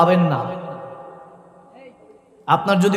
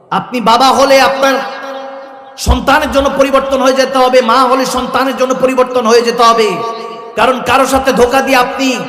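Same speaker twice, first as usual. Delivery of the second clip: higher, louder sermon exhortation; full-force preaching; 5 semitones higher, 4 dB louder than usual. Real, not acted. Preacher warning the congregation against fooling themselves, full-force preaching. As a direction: press it on the crowd, loud and high.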